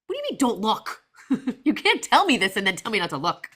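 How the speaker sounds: hammed-up indignant mom; mock indignation. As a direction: protest in playful outrage at being teased. Retold indignant reply to daughter.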